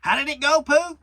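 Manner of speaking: eager Rabbit. Acted. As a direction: urge Pooh on as Rabbit. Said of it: "said Rabbit".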